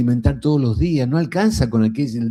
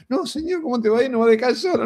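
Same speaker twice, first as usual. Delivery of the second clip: much higher, hammed-up anxious protest; pleading disciples; 13 semitones higher, faster than usual. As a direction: beg him not to go. Disciples protesting Jesus leaving.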